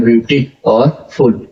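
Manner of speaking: Beauty and फुल said apart, slowly; split into syllables. Shown as a demonstration. Teacher splits the word into syllables for the class, slowly. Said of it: "दो वर्डों में कर रहे हैं".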